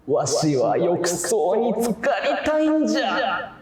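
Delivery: put-on slow, high old man voice; creaky wise old man. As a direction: play a grumpy old geezer. Complains in old-man speech style.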